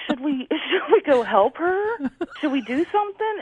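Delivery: amused, high, half-laughing question; laughing disbelief. Retold or imitated. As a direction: giggle while asking if you should help. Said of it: "And I'm like".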